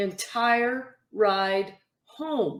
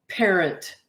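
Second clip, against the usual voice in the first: usual speaking voice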